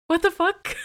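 high, loud startled question; real shock at an interruption. Real, not acted. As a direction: blurt it out, caught off guard. Speaker suddenly talks by itself.

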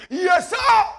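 high, loud military shout; saluting recruit. Acted. Recruit answering those who scream at him, shouted salute. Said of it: "they will salute".

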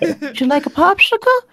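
very high, squeaky character voice; put-on silly voice. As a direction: show off a high-pitched funny voice. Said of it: "You know, something like that".

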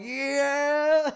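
high, loud drawn-out whoop; retold excited shout; reenacted cheer. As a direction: yell it like a thrilled fan, breaking into laughter.

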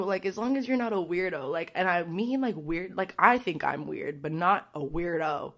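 ordinary talk

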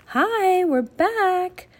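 high, loud, cheery call. A narrator's reading. Annie hails the invisible children, calling out. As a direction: call out brightly across a room. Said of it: "she called to the invisible children".

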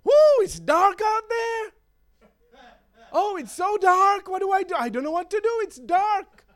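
high, panicky whining about the dark; fretful God. Retold or imitated. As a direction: play God as a worried, helpless person. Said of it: "If God was like so many people today".

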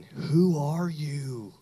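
very slow, stretched-out Southern question; thick Southern accent. Retold or imitated. Storyteller recalls the woman's line, thick Southern accent. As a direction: draw out each word in Southern. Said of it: "that line".

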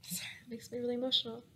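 quick breathy whisper, overcome; whispered apology. Real, not acted. Apologizing while overcome by emotion.

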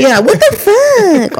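high, drawn-out indignation; exaggerated pretend fury; mock road rage. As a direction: stretch it out like an outraged driver. Acting out pissed-off driver.

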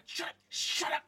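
high, loud panicky little creature; frantic high hissy shout; panicked creature voice. Acted. Second creature hushing the first.

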